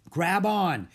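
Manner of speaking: loud, high call; shout. A narrator's reading. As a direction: call over the roaring water. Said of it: "he shouted".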